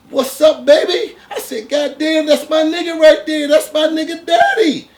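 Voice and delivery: high, loud, laughing hype-man greeting; loud fake-friendly greeting. To the armed man, overjoyed fake-friend shout. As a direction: greet him like a long-lost buddy, way up high. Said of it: "I'm still jeffing".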